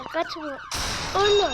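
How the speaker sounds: loud, high, one-word yell; sharp shout. Real, not acted. Gamer yells after a fleeing player, loud.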